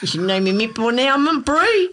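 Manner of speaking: hammed-up New Zealand accent; Kiwi accent.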